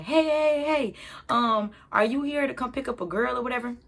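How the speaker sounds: high, quick call of hey. Retold or imitated. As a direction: flag down the running father. Man calls out to dad.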